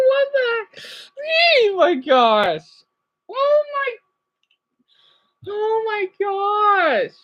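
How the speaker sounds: high, slow, teary exclamations; overwhelmed gasps. Real, not acted. Vlogger overwhelmed watching parade, teary high gasps. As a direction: let the joy spill over.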